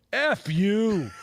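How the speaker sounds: Rodney snapping back at the heckler; mock insult to heckler. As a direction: hit the comeback hard after the pause. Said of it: "leans out and goes".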